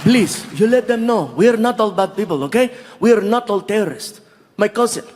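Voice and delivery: put-on earnest Saudi-accented plea; measured appeal, Saudi accent; Saudi local. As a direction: insist sincerely in an Arabic accent. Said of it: "meet some of the locals".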